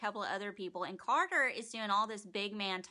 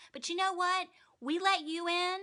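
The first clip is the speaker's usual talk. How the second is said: mock high Maggie; 8 semitones higher than usual. Maggie voiced lecturing Tara.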